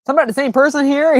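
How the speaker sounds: incredulous squeak. Retold or imitated. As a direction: squeak it out in disbelief. Retold baffled reply to compliment.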